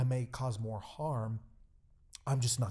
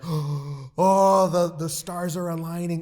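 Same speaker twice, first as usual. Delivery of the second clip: very loud, high, hammed-up elation; retold excitement; 6 semitones higher, 13 dB louder, slower than usual. Pastor reliving being asked what he does, ecstatic. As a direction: act out being overjoyed, building in volume. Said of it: "I'm getting so excited".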